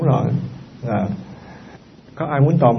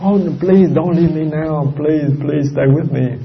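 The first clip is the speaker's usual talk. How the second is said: mock clinging plea; 3 semitones higher, slower than usual. Monk mocking a mourner who begs the dying to stay, slow mock plea. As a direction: beg a dying loved one not to leave, hammed up. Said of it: "đừng có la lên".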